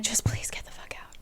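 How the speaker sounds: retold bark turned to a whisper; mock bark, whispered. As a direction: whisper "Just please get the fuck out" like a snap. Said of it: "Nesta basically barks at Varian to leave".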